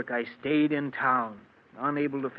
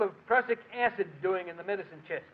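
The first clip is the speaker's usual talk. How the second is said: alarmed; 6 semitones higher than usual. Acted. Finds poison in medicine chest.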